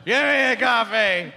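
imitating Rob Ford ordering. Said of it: "keeps trying to order stuff".

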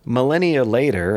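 ordinary talk

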